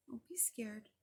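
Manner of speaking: soft comforting whisper; whispered reassurance. Real, not acted. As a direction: whisper it to calm a little one. Reader comforting listening child.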